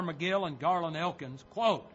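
ordinary talk

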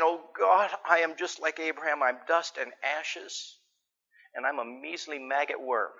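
soft, self-abasing prayer; mock pious prayer. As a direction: overdo the humility, quietly. Mock pious prayer before couch.

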